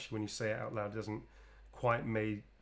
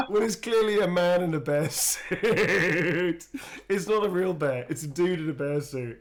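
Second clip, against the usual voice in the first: loud, high laughing talk; 7 semitones higher, 9 dB louder than usual. Real, not acted. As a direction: giggle through it. Laughing excitedly about drawing.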